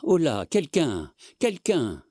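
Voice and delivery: high, slow cries for help; calling for help. A narrator's reading. Old husband calls the servants in alarm. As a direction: shout for the servants.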